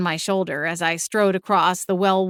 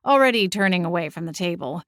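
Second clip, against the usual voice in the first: ordinary delivery, nothing marked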